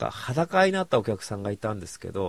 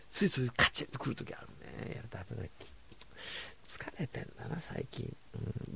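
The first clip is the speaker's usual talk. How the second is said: weary self-muttering; 14 dB quieter than usual. Real, not acted. Muttering tiredly to himself.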